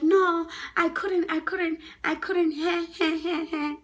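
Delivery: high, loud, drawn-out whine; acted panicky excuse breaking into whimpers; scared pleading. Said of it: "My friend was so scared".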